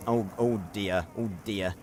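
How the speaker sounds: a fussing Archibald, low and hushed. Retold or imitated. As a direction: mutter it like a fussy English aristocrat. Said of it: "I did say this when I was playing it".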